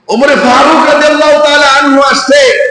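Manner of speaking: high, sustained preaching call. A narrator's reading. Preacher raises his voice in narration. (উঁচু টানা ওয়াজের সুর)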